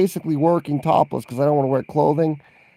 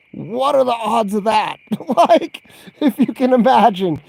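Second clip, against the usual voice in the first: higher laughing lead-in; 7 semitones higher, 4 dB louder than usual. Real, not acted. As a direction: laugh spilling into the words, higher. Laughing about broken laundromat.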